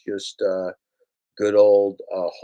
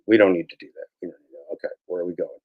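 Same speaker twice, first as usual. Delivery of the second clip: ordinary talk, nothing marked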